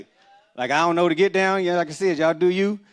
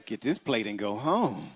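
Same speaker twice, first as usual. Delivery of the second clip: slow, lower, flat dismissal; dismissive wife; 5 semitones lower, 6 dB quieter than usual. Retold or imitated. Friend's wife sending single man home, slow and dismissive. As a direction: send him off, unimpressed and slow. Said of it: "She was like".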